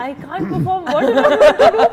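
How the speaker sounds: high, loud, laughing protest; mock helpless complaint. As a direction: wail it in comic exasperation. Acting out her complaint to director. (నవ్వుతూ నిస్సహాయంగా మొరపెట్టుకోవడం)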